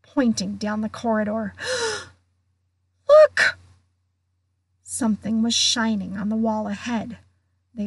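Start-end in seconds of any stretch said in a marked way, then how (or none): 1.6-3.1 sharp high startled gasp; sudden gasp. A narrator's reading. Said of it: "Hermione gave a sudden gasp". 3.1-3.6 much higher, louder shriek; startled cry. Acted. Hermione sees the message.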